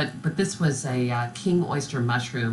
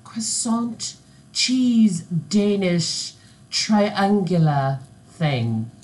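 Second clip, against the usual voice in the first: very slow, mock British presenter; monotone British accent; 5 semitones higher, 4 dB louder, much slower than usual. Baking show host describing a pastry, droning British accent. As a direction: stretch each word in a fake British accent. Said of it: "very monotone British".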